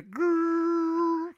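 high rumbling growl noise; mock flamethrower growl; growling fire stream.

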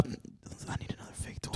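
breathy whisper, half to yourself; a sheepish whispered afterthought; whispered aside. Retold or imitated. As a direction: slip it out under your breath. Hushed aside after handing money.

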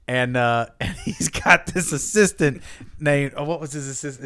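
ordinary delivery

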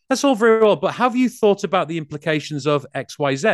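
loud, high smug heckler; mock the smart-aleck in the room; smug know-it-all. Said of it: "to try and make themselves seem smart and go".

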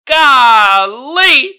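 loud laughing exclamation; laughing hard. Real, not acted.

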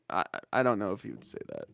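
normal talking voice

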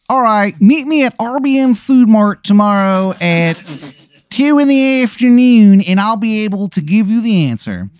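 high, loud, hammed-up Maryland accent; bad Maryland accent. Shithead Steve offering to answer any question, bad Maryland accent. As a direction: go way up in pitch with a terrible Maryland twang. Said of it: "it's the worst Maryland accent".

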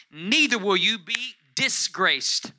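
loud, high and drawn out, phrase by phrase; scripture proclamation. Acted. Preacher reading Isaiah to the congregation, forceful slow proclamation. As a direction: declare it slowly to the whole room.

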